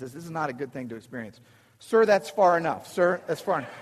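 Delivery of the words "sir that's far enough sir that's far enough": stern warning. Retold or imitated. Officer warning approaching man.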